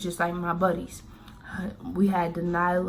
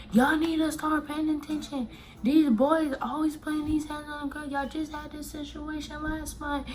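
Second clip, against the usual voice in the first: higher, sharp scolding mom voice; imitating mom snapping at the staff; 9 semitones higher than usual. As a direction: imitate mom telling off the school. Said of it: "snapping at her".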